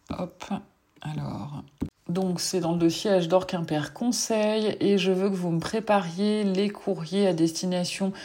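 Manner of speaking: ordinary talk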